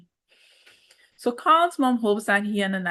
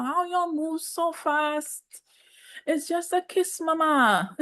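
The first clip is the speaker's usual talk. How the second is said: high, slow teasing disbelief; mock-exasperated, high and drawn out; playful scolding; 9 semitones higher, slower than usual. Real, not acted. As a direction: tease mom for rushing. Teasing mom, pitched high.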